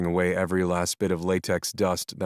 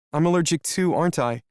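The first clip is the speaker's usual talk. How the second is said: frightened; 10 semitones higher, 3 dB louder than usual. Acted. Melody frightened.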